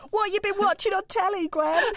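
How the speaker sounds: high, mock-casual question; playfully lightened tone. Co-host changing subject to telly, playfully lightened tone. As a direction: switch into chirpy banter, hammed-up. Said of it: "I need to lighten the tone now".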